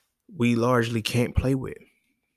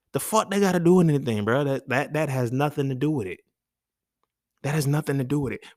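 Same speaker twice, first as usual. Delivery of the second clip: high, loud burst of disbelief easing off; exasperated pushback, starting high and loud; indignant retort; 4 semitones higher, 4 dB louder than usual. Real, not acted. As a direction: fire back indignantly, then settle slightly. Indignant retort to friend's account.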